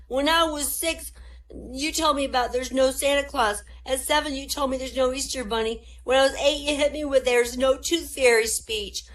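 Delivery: put-on high child's voice, indignant; high whiny son listing every lie; aggrieved kid. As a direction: complain like a kid betrayed by his father. Son lists lost childhood myths.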